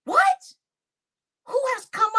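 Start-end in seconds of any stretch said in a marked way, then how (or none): 0.1-0.5 sudden high-pitched disbelieving what; shocked what. Real, not acted. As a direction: jump up in pitch in pure shock. Shocked at news from chat.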